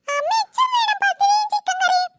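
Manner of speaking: put-on high, lisping little child voice; toddler voice. Little brother begs mother for pathiri, high toddler voice. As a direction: beg like a tiny toddler.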